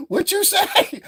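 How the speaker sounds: high-pitched challenge from a wig; talking wig voice. Pretend. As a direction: give the wig its own cartoon voice. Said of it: "got his own voice".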